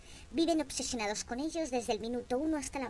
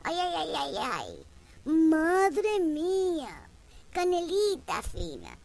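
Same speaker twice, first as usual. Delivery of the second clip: high, loud, very slow drawn-out "Madre mía"; old man's leering sigh; 6 semitones higher, 6 dB louder, much slower than usual. Retold or imitated. Pensioner sighing after a blonde, high, loud and drawn out. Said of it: "se ha quedado solo en".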